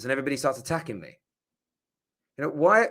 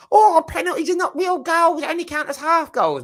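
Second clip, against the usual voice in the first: high, loud whiny sneer; mocking rival fans; 6 dB louder than usual. Mocking critics who say penalties are half goals, high loud whine. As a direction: whine it like a dismissive rival fan.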